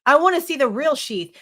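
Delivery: ordinary talk, nothing marked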